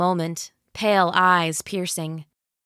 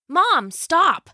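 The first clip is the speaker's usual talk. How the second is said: higher, sharp snap; snapping; 9 semitones higher, 3 dB louder than usual. Acted. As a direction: cut her off impatiently. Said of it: "Jill snapped".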